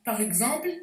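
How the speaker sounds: ordinary talk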